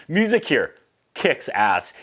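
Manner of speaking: usual speaking voice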